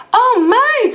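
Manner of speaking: put-on old lady startled again; startled old woman. A narrator's reading. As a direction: squeal like a frightened granny. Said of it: "cried the tiny old woman".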